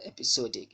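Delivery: "episodic" as "ep-i-SOH-dik"; wrong pronunciation. Shown as a demonstration. Teacher quoting what one might think, wrong pronunciation. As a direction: keep the long "oh" of episode in "episodic". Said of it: "you're wrong".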